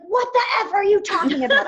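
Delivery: loud, high laughing outburst of disbelief; furious retort. Retold or imitated. To her coach on the phone, a laughing angry shout. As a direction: blurt it out half-laughing, half-outraged. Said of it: "I was so mad".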